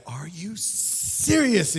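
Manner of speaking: drawn out like you can't believe it; a parent staring at a mess, exasperated; exasperated disbelief. Retold or imitated. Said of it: "throwing something down in their anger".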